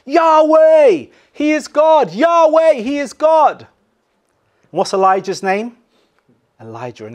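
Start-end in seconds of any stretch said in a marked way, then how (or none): 0.0-3.6 high, loud ecstatic cry; loud worshipping cry; crowd crying out. Retold or imitated. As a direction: shout it like a crowd in awe. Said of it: "the people cry out".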